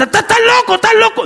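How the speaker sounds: repeated high-pitched yelling; exasperated governor crying out; acted shout. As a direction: holler the accusation over and over. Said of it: "le grita a Festo".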